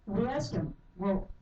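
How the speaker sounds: normal talking voice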